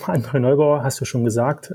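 usual speaking voice

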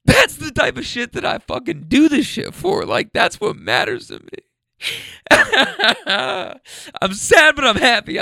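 high, crying through the words; openly emotional, sad and happy at once; real tears. Real, not acted. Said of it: "I'm getting emotional".

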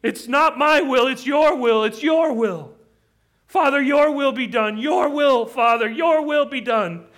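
loud, high cry of anguished surrender; desperate, insistent prayer at full cry; horrified. Acted. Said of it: "horrified as he is".

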